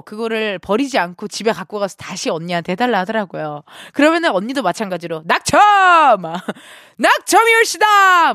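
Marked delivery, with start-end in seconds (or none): 5.2-8.3 much higher, louder, slow grand proclamation with a laugh; mocking announcer. Older sister teasing dad with a pompous lottery-loss proclamation. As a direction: rub it in like a herald. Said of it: "아버지의 속을 좀 긁어놓는".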